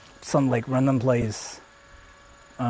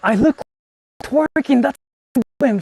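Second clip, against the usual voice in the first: a much higher, louder yelp of embarrassment; embarrassed squeal; 8 semitones higher, 6 dB louder than usual. Real, not acted. Streamer sees own pose on camera, squealing embarrassed. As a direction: caught looking silly, squeal it out.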